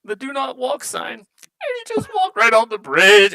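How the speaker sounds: high, tearful dwarf recounting his friend's death; weepy, high-pitched dwarf telling the tale; crying dwarf. Acted. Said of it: "like, crying, he's like".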